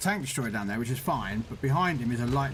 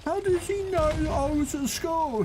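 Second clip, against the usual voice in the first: put-on much higher, slow, wide-eyed child voice; imitating an amazed kid; 3 dB louder than usual. Mate's son amazed the streamer knows about school, childlike. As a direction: do the boy, baffled and amazed. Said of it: "he's sitting there going".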